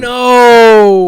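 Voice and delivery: long, loud no; loud protest. Real, not acted. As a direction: belt out a drawn-out no.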